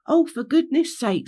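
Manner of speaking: high exasperated outburst. Acted. Rainbow fed up with Henry over the gate, exasperated. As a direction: huff it at the whiner.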